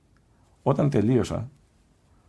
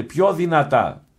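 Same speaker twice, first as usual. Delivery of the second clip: higher, drawn-out call for volume; loud demand; 7 semitones higher, 4 dB louder, much slower than usual. Retold or imitated. Monk ordering the confessing visitor to speak up, raised and drawn out. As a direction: imitate a deaf man demanding, slowly.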